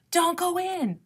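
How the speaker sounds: restrained storybook shout; alarmed cry. A narrator's reading. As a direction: cry out a warning without real volume. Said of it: "Raccoon shouted".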